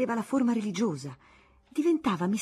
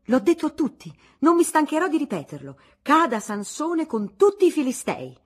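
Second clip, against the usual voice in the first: high and loud, proclaiming to everyone; ringing loud voice; 8 semitones higher, 9 dB louder than usual. Acted. Aunt Lucrezia declaring to the whole salon, ringing and loud. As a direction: trumpet it out like an indignant aunt. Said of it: "una voce squillante".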